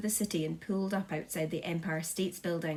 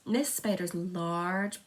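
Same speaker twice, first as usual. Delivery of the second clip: ordinary delivery, nothing marked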